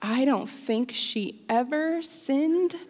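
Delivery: slow, drawn-out mock preacher delivery; passionate preacher voice. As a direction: imitate a child playing passionate preacher, stretching the words. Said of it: "In my very passionate preacher voice".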